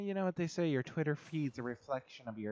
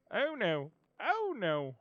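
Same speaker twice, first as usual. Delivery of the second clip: mock panic; 8 semitones higher, 5 dB louder, slower than usual. Real, not acted. Game creature attacks.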